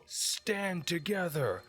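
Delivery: rally the troops against Porter, high and slow; rallying war cry. Acted. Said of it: "And he goes".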